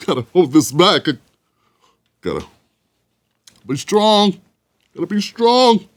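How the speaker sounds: hammed-up brave sniffle; mock defiant cry. Host parodying a pundit fighting back tears, mock choked-up. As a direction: fake choked-up voice, trying to hold back tears. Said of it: "That's right, the defiant cry".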